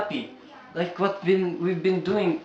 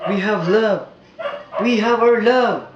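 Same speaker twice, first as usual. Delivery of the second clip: loud, higher emphatic declaration; passionate insistence; 6 semitones higher, 9 dB louder than usual. Acted. George insists to Sabina, loud passionate declaration. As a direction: declare it with all your heart.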